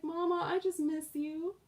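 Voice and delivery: put-on small, high baby-animal plea; voicing the bunny. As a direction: speak for a needy pet missing Mama. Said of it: "He's like".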